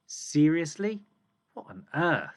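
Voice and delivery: loud, high rising Seriously, then a stunned What on earth; exaggerated amazement for the class; shocked disbelief. Shown as a demonstration. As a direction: sound truly astonished at the news. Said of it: "or are you gonna read it like this?".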